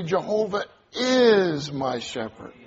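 "is" held long and punched; stress on is. Shown as a demonstration. Preacher emphasizing different words of the verse, stress on "is". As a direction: lean hard on "is" and stretch it out. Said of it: "We can even emphasize different words".

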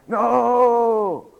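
long, high, loud no; shouted denial. Retold or imitated. Peter denying a third time, drawn-out shout. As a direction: wail out an exaggerated refusal.

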